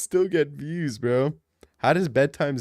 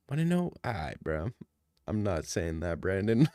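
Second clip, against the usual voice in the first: mock whisper; 7 semitones lower, 7 dB quieter than usual. Sudden whispered aside.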